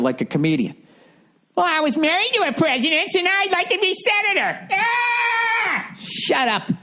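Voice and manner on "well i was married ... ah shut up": much higher, shouting caricature voice, cutting off with a yelp; shrill mock Hillary. Comedian mocks Hillary running for senator, high shrieking voice. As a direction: screech it like a grating caricature. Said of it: "listen to that fucking voice".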